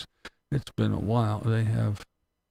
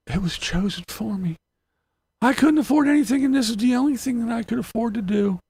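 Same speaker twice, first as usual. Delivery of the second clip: higher, pleading mock victim voice; mock self-pity; 5 semitones higher, 3 dB louder than usual. Imitating van dwellers defending their life, mock self-pity. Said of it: "in other words".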